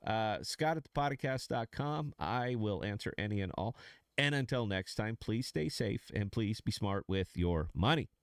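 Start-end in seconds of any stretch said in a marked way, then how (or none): none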